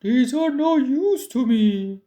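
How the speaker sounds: a troll tossing away useless gold; troll unimpressed by gold; scornful troll. A narrator's reading. Troll dismissive.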